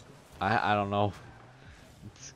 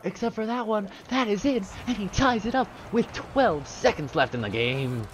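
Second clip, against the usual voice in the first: excited caster call; 10 semitones higher than usual. Real, not acted. Caster hype on tying goal.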